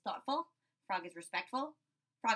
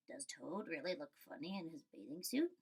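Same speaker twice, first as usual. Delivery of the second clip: lower, softer lizard voice; curious lizards; 6 semitones lower, 9 dB quieter than usual. Acted. Lizards asking Frog, low and soft. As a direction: lizards wondering aloud. Lizards ask about Toad.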